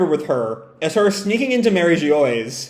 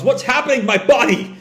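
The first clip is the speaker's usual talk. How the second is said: alarmed yell; 8 semitones higher than usual. Acted. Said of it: "As Sanji yells".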